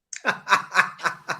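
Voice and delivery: high-pitched burst of laughter; laughing. Real, not acted. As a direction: laugh at your own ridiculous look. Laughing at his own look.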